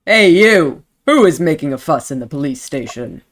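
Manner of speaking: stern officer demanding an answer; Sergeant Singh. Acted. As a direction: call out sharply, then drop into authority. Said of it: "you wanna be Sergeant Singh?".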